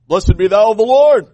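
booming mock-pious proclamation; loud, hammed-up holy hail; hammed-up pious greeting. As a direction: proclaim it loudly, laying on the false piety. Plays Saul greeting Samuel falsely.

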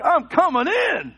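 loud, high, slow declaration; hammed-up determined father's yell; mock battle cry. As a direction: shout it like storming into a room. Father acts out showdown with baby.

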